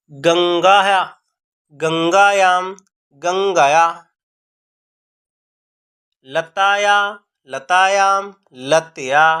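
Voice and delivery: each word spaced and said distinctly; careful recitation. Shown as a demonstration. Teacher models the correct pronunciation for students, careful recitation. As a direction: recite like a pronunciation drill, one word at a time. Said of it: "का शुद्ध उच्चारण करें".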